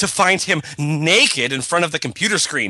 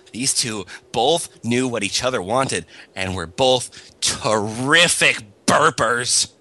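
ordinary delivery

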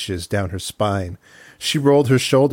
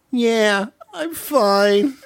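much higher whine, stress on wear; whiny high Thea voice; 12 semitones higher, much slower than usual. Acted. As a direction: whine it like a sulky child. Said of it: "Thea said".

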